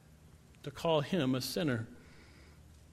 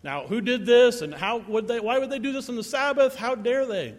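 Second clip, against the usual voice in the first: mock outrage, climbing higher in pitch; scandalized accusers, building to a high, louder protest; indignant Pharisees; 6 semitones higher, 3 dB louder than usual. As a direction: act out the scribes' indignant objection. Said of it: "The Pharisees and scribes".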